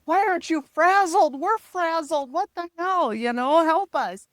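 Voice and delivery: high, loud, frantic pleading; mock panic. Parents begging the casual lawyer to help, in mock panic. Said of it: "in panic mode".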